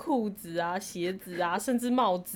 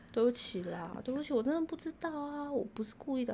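soft, sheepish retold apology; quiet apology; 3 semitones higher, 9 dB quieter than usual. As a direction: softly plead sorry. Apologizing to upset friend.

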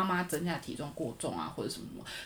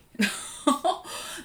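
embarrassed laugh; 11 semitones higher, 5 dB louder than usual. Real, not acted. Embarrassed laugh at leaking story.

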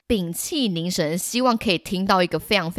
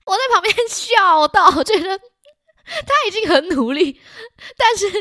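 higher, louder words through laughter; laughing while talking; 12 semitones higher, 6 dB louder than usual. Real, not acted. Recalling laughing beside classmate, higher, louder, laughing. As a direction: talk through your own laughter.

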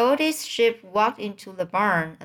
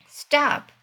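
higher, sudden cry to halt, only half-screamed; mild scream; 6 semitones higher, 3 dB louder than usual. A narrator's reading. Wilbur cutting off the old sheep's talk of butchering, mildly screamed. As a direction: cut in with a restrained shriek. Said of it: "screamed Wilbur".

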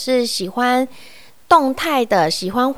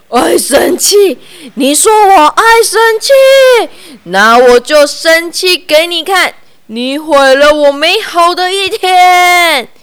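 higher, louder angry bear shout; 6 semitones higher, 9 dB louder than usual. Acted. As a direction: bellow it like a furious bear.

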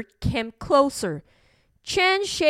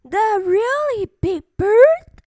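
high, louder chirpy question; little birds' chorus; 8 semitones higher, 5 dB louder than usual. A narrator's reading. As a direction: ask like astonished little birds, pitched up. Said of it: "asked the little birds in chorus".

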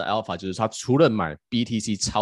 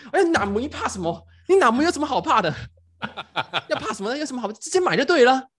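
retold loud scoff; 14 semitones higher, 6 dB louder than usual. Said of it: "然后他就说".

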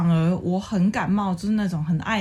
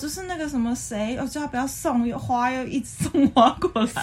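lightly voiced, higher fake-troubled bragging; mock humblebrag; 5 semitones higher than usual. Mocking a girl who humblebrags about a suitor's flowers. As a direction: play a girl faking annoyance to brag. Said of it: "女生也有这种人".

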